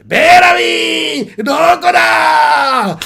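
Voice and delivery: high, slow drawn-out call; anime-style stretched call. Retold or imitated. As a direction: shout his name across the town. Luffy calling out for Bellamy.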